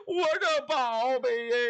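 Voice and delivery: slow, drawn-out lament; keen over the lost treasure; mock wailing. Priest mourns his treasure, hammed-up wailing. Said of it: "这俩都哭了".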